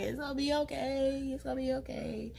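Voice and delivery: high, soft soothing; gently calming her down. Retold or imitated. Auntie comforting her niece, high and soft.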